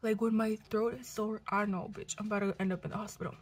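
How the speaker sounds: usual speaking voice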